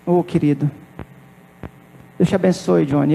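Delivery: a parody of a canned pastor's prayer, said soft and low; mock professional prayer. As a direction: rattle off a routine blessing, half-hearted. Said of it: "aquela oração profissional".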